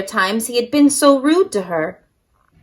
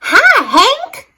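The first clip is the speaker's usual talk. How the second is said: Rosie calling out; 13 semitones higher than usual. Acted. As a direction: call out bright and high like a bubbly tumbleweed. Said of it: "Rosie the Tumbleweed called out".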